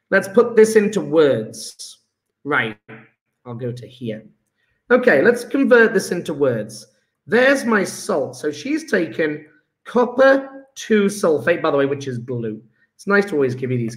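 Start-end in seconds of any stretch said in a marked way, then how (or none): none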